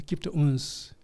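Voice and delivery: usual speaking voice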